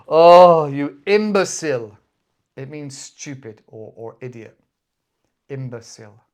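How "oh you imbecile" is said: loud mock outburst; hammed-up, much louder scolding; exasperated insult. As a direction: burst out as if fed up with a fool. Acting out the favourite insult.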